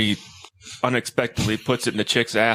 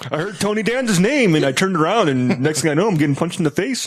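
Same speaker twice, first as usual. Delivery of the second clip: higher-pitched mock explanation; rambling excuse in a raised pitch; acted-out excuse; 5 semitones higher than usual. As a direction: play someone explaining away an injury at work. Acting out an excuse at work.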